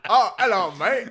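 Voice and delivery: high, loud British greeting; hammed-up British accent, up high; British clown voice. Clown greets lost adventurers in a loud British accent. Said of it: "they sound British".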